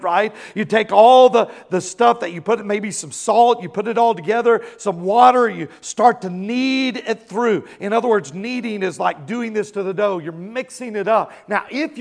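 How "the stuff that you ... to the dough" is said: usual speaking voice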